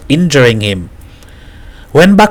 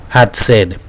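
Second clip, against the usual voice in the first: ordinary delivery, nothing marked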